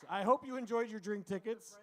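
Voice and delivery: usual speaking voice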